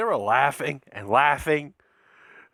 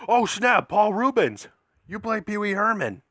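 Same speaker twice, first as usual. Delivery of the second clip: higher-pitched starstruck outburst; excited recognition; 6 semitones higher than usual. Retold or imitated. Fan recognizing Paul Rubens, retold in excitement. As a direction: act out suddenly recognizing someone famous.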